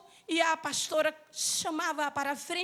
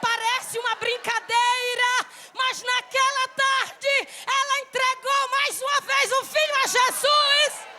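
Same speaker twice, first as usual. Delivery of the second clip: rising, slow, louder preaching build; fervent preaching; 8 semitones higher, 4 dB louder, slower than usual. Acted. Preacher to congregation, slow fervent rising build. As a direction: build the line up toward a cry.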